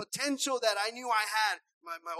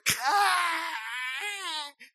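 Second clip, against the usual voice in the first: mock child wail; 4 semitones higher than usual. Child bumping head and crying.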